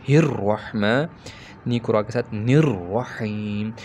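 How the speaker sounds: हिर्रहमान, निर्रहीम, slow, leaning hard on the doubled र्र; doubled ra stress. Shown as a demonstration. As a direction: join हि and नी strongly to रा, slowly. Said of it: "strongly आपने मिलाना है".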